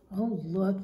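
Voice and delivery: usual speaking voice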